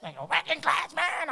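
high, loud, laughing exasperation; mock outrage at dawn noise; rough rocker bird. Pastor complaining about noisy dawn birds, mock outrage. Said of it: "birds sound like [name]".

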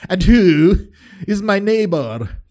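hammed-up BBC English for prideful people; high, prideful British broadcaster accent. Teacher of the law challenging Jesus, mock-pompous BBC accent. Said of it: "my B B C accent for prideful people".

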